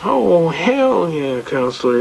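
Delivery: stretched-out mock relish; flippant judge. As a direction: imitate a judge drawling it at counsel. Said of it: "kinda flippant".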